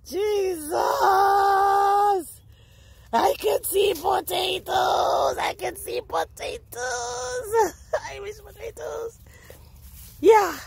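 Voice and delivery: high, slow cries of delight with a laugh; ecstatic shouting. Real, not acted. Gardener finding Irish potatoes, ecstatic high shouts. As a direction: shout it to the sky, beside yourself with joy. Overjoyed discovery.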